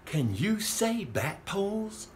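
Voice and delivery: usual speaking voice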